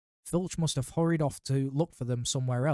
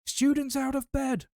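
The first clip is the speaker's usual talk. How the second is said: higher, very high bellowing poltergeist; 13 semitones higher, 3 dB louder than usual. A narrator's reading. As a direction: bellow it down the corridor. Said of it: "Peeves bellowed".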